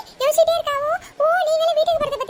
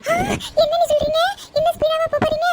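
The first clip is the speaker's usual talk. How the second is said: alarmed disbelief; 3 semitones higher, 3 dB louder than usual. Acted. Alarmed at more speed.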